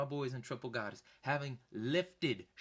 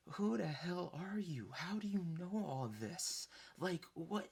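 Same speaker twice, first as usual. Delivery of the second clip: slow, higher-pitched imitation of confusion; bewildered; 6 semitones higher, slower than usual. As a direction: demand an explanation, slowly, utterly baffled. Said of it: "So now he's all confused".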